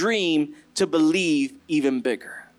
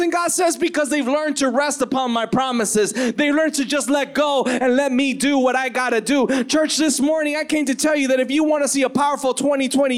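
high, driving preacher delivery; impassioned sermon building to its close; full-force preaching; 5 semitones higher than usual. Real, not acted.

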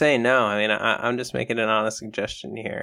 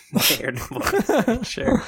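mock announcement; 11 semitones higher, slower than usual. Mock-grand naming of mayor.

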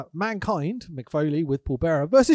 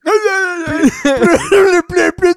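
loud, high mock promo blather; mock wrestler voiceover; 12 dB louder, slower than usual. Imitating Sid's voiceover.

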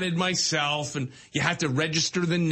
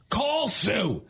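higher, mock-yelled command; yelling dad; 5 semitones higher than usual. Dad yells before the beep, high mock yell. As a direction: imitate dad hollering at the car. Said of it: "he's yelling before the beep".

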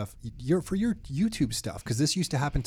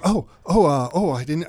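flustered stammer; 3 semitones higher, 7 dB louder than usual. Retold or imitated. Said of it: "caught the guy off guard".

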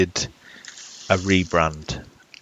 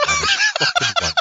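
laughing. Real, not acted. Laughing at a joke skin.

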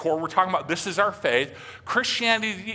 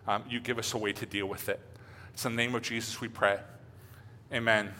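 prayer; 4 semitones lower than usual. Acted. Pastor leads a prayer.

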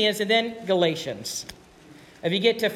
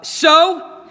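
a sharp, high, loud "so" to hurry him; impatient prompt; 8 semitones higher, 7 dB louder than usual. Retold or imitated. Eddie prompting Swindoll through the verse, impatiently. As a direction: snap the cue at him, out of patience. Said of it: "Eddie added impatiently".

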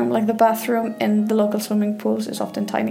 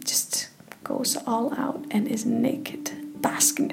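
slow, soft whispered aside; cheeky whisper; 8 dB quieter, slower than usual. Acted. Vlogger teasing tourists about nudity, sly whisper. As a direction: let them in on a naughty secret.

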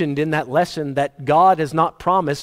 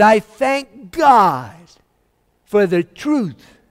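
slow, raised, fervent thanks; grateful old man; 6 semitones higher, 5 dB louder, much slower than usual. Retold or imitated. Healed believer thanking his pastor, slow fervent gratitude.

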